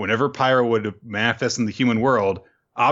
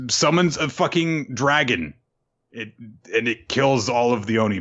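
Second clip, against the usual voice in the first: usual speaking voice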